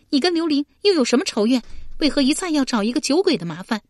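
高声快速地反问; 不耐烦地连珠发问; 急声质问. A narrator's reading. 佩娘高声急问. (佩娘急着质问黑衣男子)

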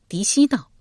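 ordinary delivery

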